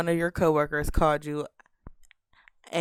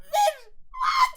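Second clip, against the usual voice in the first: a real shriek of disbelief mid-laugh; shocked laughing shout. Real, not acted. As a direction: squeal it out high through laughter, stunned.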